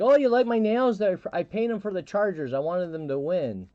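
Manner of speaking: put-on high, loud chatty girl voice; tipsy girl. Tipsy girl showing off her painted nails, high gushing voice. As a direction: gush about her nails. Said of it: "she's like".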